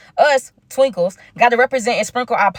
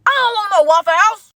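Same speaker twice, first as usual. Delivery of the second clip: indignant outburst; 10 semitones higher, 4 dB louder than usual. Retold or imitated. Retold refusal of Waffle House.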